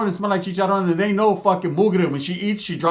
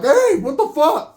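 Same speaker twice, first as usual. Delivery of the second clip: slow, higher indignant yell; indignant outcry; 9 semitones higher, 3 dB louder, slower than usual. Retold or imitated. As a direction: protest being grabbed. Getting arrested, protesting.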